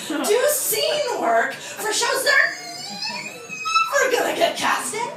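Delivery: teacher yelling at the class; mock ranting teacher. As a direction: rant like a furious acting coach.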